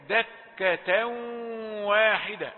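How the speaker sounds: "دكة واحدة" with n merged into nasal w; slow and loud, hum into "واحدة"; merged tanween. Shown as a demonstration. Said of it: "كذلك". (إدغام بغنة في واو "واحدة")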